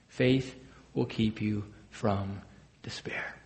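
slow, fading to a breathed despair; hushed solemn point. Acted. Preacher warning friends, fading to a whisper. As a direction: let it sink to a whisper.

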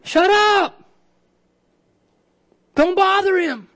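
high-pitched mock scolding voice; stern hushing. Crowd telling blind men to leave Jesus alone, stern hushing. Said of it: "The crowd sternly told them to be quiet".

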